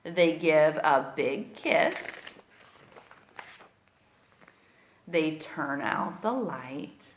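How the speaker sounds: put-on storybook read-aloud for little kids; reading to children. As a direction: read it like bedtime story time. Reads picture book aloud to kids.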